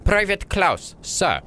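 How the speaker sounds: high, crisp name-and-rank reply; eager private answering an officer; soldier reporting. Acted. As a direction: pitch the name high, drop on sir. The first soldier responded with.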